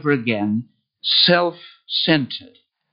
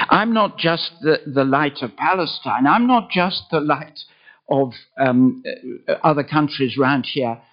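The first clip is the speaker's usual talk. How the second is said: usual speaking voice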